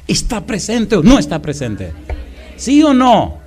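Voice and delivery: loud, high challenge to the crowd; rising preacher's shout; preacher's rallying call. Acted. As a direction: demand an answer from the pews. Preacher calls to congregation.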